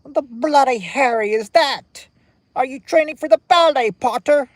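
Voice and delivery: loud, high, hammed-up British accent. Shown as a demonstration. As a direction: shout it out in an exaggerated high British voice. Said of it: "I can do a British accent".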